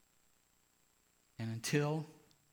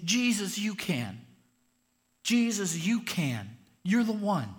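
loud, high fervent cry; crying out to Jesus; 8 semitones higher, 8 dB louder, slower than usual. Acted. Paul calling out to Jesus, loud and fervent. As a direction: call out to Jesus in hope. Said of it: "He's saying".

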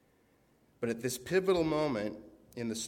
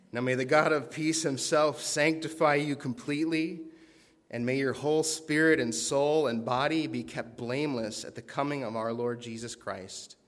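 scripture reading; 3 semitones higher, 6 dB louder than usual. Acted. Reading scripture benediction aloud.

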